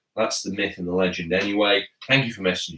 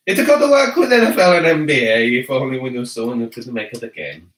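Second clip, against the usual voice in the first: hammed-up Italian accent; 6 dB louder than usual. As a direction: read it aloud with a comic Italian lilt. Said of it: "when I read it in the Italian accent".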